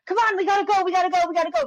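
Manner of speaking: high, loud rushed mom chant; hurried nagging. Retold or imitated. Mom rushing everyone out the door, high and loud. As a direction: rattle it off like a frantic mom.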